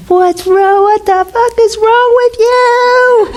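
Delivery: high, slow mock yell at the man; retold yelling. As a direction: shriek it like she's losing it at him. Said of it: "I start yelling at him".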